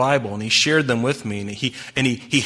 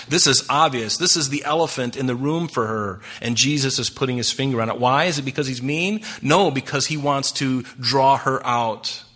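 normal talking voice

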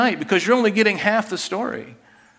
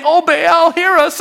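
high, loud wailing plea; Baal prophets crying out louder, a high mocking wail; mock desperate cry; 11 semitones higher, 7 dB louder than usual. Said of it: "they cried out the more, saying the same thing".

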